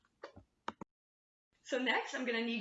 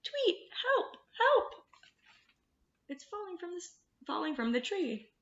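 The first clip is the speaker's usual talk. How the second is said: very high, tiny chirping bird voice; little bird voice; 9 semitones higher, 3 dB louder than usual. Acted. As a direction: squeak it like a panicked baby bird. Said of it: "this little bird says".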